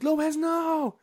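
high, loud drawn-out mock shout; acted warning cry. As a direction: imitate friends yelling after a doomed man. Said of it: "They're like".

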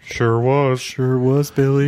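high cheery yokel voice; folksy sidekick. Pretend. Playful scripted reply.

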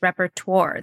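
repertoire said REP-er-twor; American English repertoire. Shown as a demonstration. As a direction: sound the Rs in repertoire. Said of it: "American English way of saying that".

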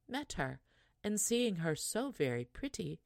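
usual speaking voice

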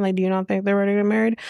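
quick, rushed comeback, words tumbling out; rapid retort. Retold or imitated. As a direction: fire the question back fast. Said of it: "And she's like".

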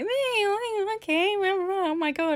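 lightly voiced high moan of self-pity; mock self-pity. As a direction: whine mildly about being miserable. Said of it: "just like".

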